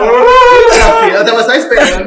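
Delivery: high, laughing and teary; crying with laughter; laughing, near tears. Real, not acted. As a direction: laugh through the words, close to crying. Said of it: "quase chorando só de lembrar".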